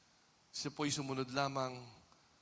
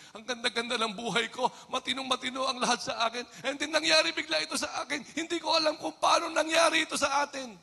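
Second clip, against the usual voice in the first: higher, louder, faster rising complaint; distressed believer; 10 semitones higher, 8 dB louder than usual. Acted. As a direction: build from bewildered to shouting. Voicing a troubled believer. (naguguluhang pasigaw na daing)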